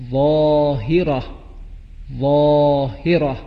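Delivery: ظاهرة with a heavy, full ظ, said slowly; ظاء demo. Shown as a demonstration. Teacher contrasts ظاء with ذال for pupils, slow heavy ظ. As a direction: give ظاهرة a thick, rounded ظ. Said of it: "دیگری حرف وا".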